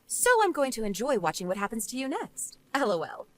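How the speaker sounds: understated dismissive woman; unsympathetic rebuff. Acted. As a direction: coolly refuse the blame. Jane refuses to forgive.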